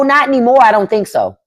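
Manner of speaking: normal talking voice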